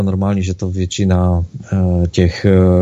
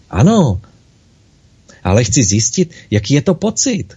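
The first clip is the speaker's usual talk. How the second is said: higher, eager, pleading little soul; crying out; 6 semitones higher than usual. Acted. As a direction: insist with childlike longing. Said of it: "křičela malinká duše".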